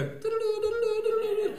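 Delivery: high singsong diddle-doo; very high falsetto ditty; singsong greeting. Retold or imitated. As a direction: chirp it up high like a silly greeting. Friends greet him singsong.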